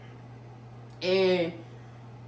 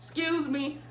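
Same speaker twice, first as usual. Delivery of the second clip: polite request; 7 semitones higher than usual. Retold or imitated. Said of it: "but I did say".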